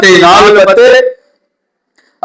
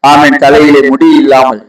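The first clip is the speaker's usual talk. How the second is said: tender appeal; 7 semitones lower than usual. Real, not acted. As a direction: lean in and speak gently to the hurting. (தாழ்ந்த குரலில் மென்மையான அழைப்பு)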